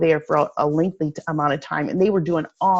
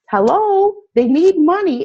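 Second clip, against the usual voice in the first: exasperated hello; 10 semitones higher, 5 dB louder than usual. Real, not acted. Exasperated point about missed offers.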